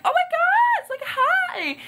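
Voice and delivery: very high, loud mock squeal; imitated excited scream. Rebecca greeting Josie Ann, imitated high excited squeal. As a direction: squeal hello like thrilled to see her. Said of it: "she, like, screams".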